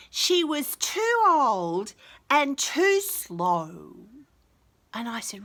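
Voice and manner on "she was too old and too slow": put-on slow, higher little-girl voice, dragging each word; imitating a little girl. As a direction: play the child stating it plainly and slowly. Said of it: "one little girl said to me".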